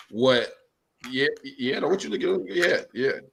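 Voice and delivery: ordinary talk, nothing marked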